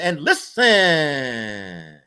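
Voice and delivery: very long, loud, falling LISTEN; grandfather's holler. Retold or imitated. Grandfather demanding the boy's attention, long drawn-out holler. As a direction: stretch it into a slow, sliding holler. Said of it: "That's what he would talk".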